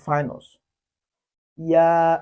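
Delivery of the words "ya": loud, drawn-out ya for students to copy; Chinese ya. Shown as a demonstration. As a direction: model ya clearly and loudly. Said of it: "repeat after me".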